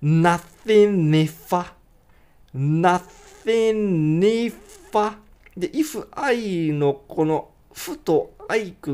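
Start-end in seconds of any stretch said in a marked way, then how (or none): 0.0-5.2 slow "nothing if I", N flowing into I; linked if I. Shown as a demonstration. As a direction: link N and I. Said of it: "NでIが繋がって".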